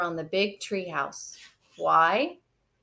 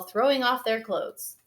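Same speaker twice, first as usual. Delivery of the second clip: plain reading voice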